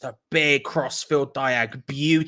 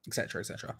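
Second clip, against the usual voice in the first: 1 whispered aside; 7 semitones lower, 13 dB quieter, much faster than usual. Real, not acted. Trailing off a boast.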